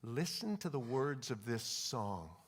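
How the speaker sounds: low, soft, slow voice of God; hushed, unhurried divine nudge; gentle whisper. Retold or imitated. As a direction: speak tenderly, as a private prompting. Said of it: "the Lord whispers to me".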